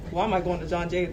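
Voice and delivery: normal talking voice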